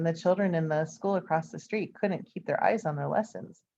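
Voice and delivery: ordinary delivery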